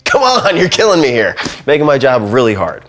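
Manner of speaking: high, loud mock pleading of a frustrated audio tech; hammed-up complaint from the audio crew; exasperated sound guy. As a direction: whine it like a fed-up engineer. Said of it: "they're just like".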